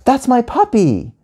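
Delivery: put-on high, slow, insistent kid; high-pitched drawn-out claim; child protesting. A narrator's reading. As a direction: protest like a possessive little kid. Anya protests to the teacher.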